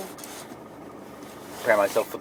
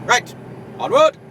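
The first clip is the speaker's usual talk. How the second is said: high, loud call; rallying cry; 7 dB louder than usual. Real, not acted. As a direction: cry it out like a charge. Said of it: "rallying cry".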